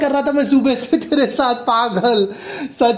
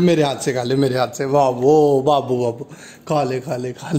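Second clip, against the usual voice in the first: coaxing; 9 semitones lower than usual. Acted. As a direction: coax her gently to take a bite. Husband coaxes crying wife to eat.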